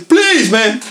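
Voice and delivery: high, pleading voice; whiny plea. Retold or imitated. Drunk friend begging the narrator not to leave, high whine. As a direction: beg as he walks away.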